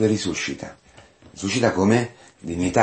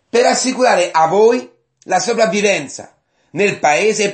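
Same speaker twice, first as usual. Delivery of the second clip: high, ringing preacher's proclamation; sustained high-pitched, emphatic Bible reading; impassioned scripture declamation; 11 semitones higher, 5 dB louder than usual. Acted. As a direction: proclaim Joseph's words fervently, pitched high. Declaims Joseph's speech from scripture.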